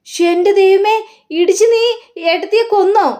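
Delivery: high, alarmed gasp of disbelief; horrified, pitch shooting way up; a shocked brother-in-law crying out high. Acted. Sreekanth shocked at Varsha's news.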